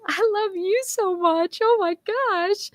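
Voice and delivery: put-on high, gushing baby talk; doting mom voice. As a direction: coo it back like a smitten mother. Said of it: "And I'm like".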